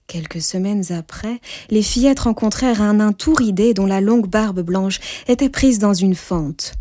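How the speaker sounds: plain reading voice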